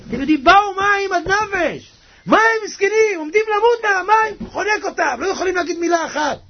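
high-pitched, urgent preacher; fervent preaching. Real, not acted. Preaches at full force.

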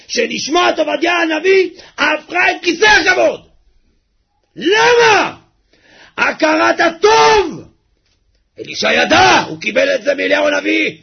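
high crying shout; full-force sermon climax; impassioned preaching. Acted. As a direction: cry out the lesson.

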